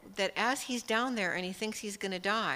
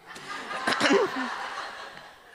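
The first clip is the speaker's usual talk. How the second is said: laughing cough; 7 semitones higher, 6 dB louder than usual. Real, not acted. Laughs at her own story.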